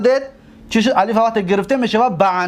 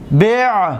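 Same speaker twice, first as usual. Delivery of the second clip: isolated stressed بیع; emphasized model word; much slower than usual. Shown as a demonstration. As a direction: set بیع apart for the class to hear. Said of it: "ببینید،".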